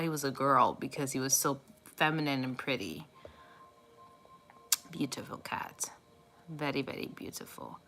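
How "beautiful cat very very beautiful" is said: soft admiring whisper. Real, not acted. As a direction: breathe the praise quietly. Admiring her late cat.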